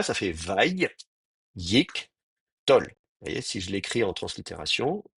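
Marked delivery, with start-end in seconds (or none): 0.3-2.8 "Vaï, Yik, Tol" as three syllables with clear pauses; each of "Vaï", "Yik", "Tol" set apart on its own. Shown as a demonstration. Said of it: "dans le découpage en syllabes".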